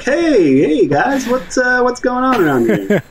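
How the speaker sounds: squeaky high voice far above his own; freakish disguise voice. Acted. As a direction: pitch way up like a goofy disguised stranger. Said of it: "I come out and I'm like, look kind of freakish".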